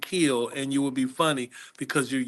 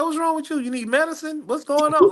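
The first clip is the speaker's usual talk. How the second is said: high, incredulous mock reply; baffled himself; 13 semitones higher, 3 dB louder than usual. Comedian puzzled by the shaking manager, high mock disbelief. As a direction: act baffled at the fuss. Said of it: "I'm looking at you like".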